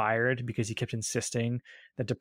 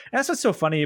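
ordinary delivery

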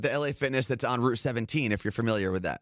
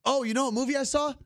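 excited; 12 semitones higher than usual. Real, not acted. Sudden excited topic change.

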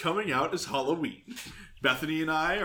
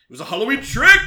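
louder, higher gleeful announcement; spring the reveal with a big finish; triumphant reveal; 5 semitones higher, 10 dB louder, faster than usual. Pretend. Mock reveal of a joke.

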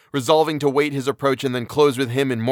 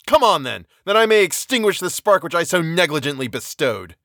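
raging outburst; 5 semitones higher than usual. Acted. Victor screams defiance at his creature, raging shout. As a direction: spit curses at the monster. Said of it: "My rage was without bounds".

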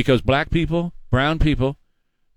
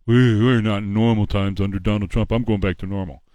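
put-on lower, mumbling old-man take on Biden; lower, mumbled Biden parody; mocking Joe Biden; 7 semitones lower than usual. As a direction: drop the voice low and mock the president. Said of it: "this was the president".

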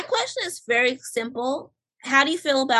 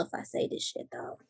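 fast guilty whisper aside; 14 dB quieter, faster than usual. Real, not acted. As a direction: whisper it under your breath, quickly.

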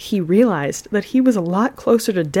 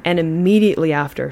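ordinary delivery

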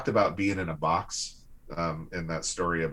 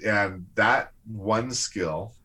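usual speaking voice

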